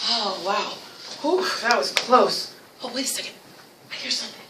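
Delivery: soft relief sinking into very quiet suspense; hushed suspense. Pretend. Teacher plays escape from the beast, hushed suspense. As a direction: go hushed, as if listening.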